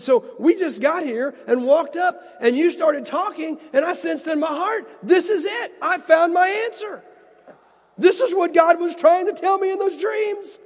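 rising high and loud with wonder; excited retelling. Retold or imitated. Isaac retells his dream revelation.

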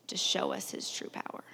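breathy hushed whisper. Real, not acted. Preacher whispers the line to the congregation. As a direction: drop to a whisper to land the point.

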